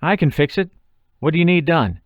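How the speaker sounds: high, tense and anxious; a scared friend blurting out an offer to help; panicked. A narrator's reading. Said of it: "Paul's voice sounded panicked".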